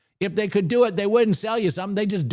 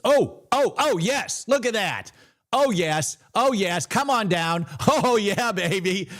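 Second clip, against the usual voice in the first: higher pitched joyful oh yes with a laugh; thrilled cheering; 5 semitones higher, slower than usual. Real, not acted. Trader watching the price drop, thrilled cheering. Trade finally goes his way.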